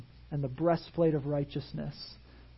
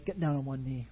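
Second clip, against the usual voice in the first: ordinary talk, nothing marked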